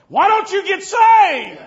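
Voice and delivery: high, loud, slow plea; urgent altar call. Real, not acted. Preacher urging sinners to get saved, shouting. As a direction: shout the invitation at the congregation.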